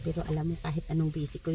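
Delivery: usual speaking voice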